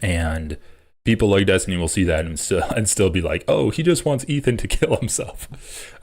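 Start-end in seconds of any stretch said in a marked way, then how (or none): 3.5-6.0 higher-pitched mocking quote, breaking into a chuckle; mock reaction. Imitating critics reacting to an olive branch, mocking. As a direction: mimic a critic, amused and higher.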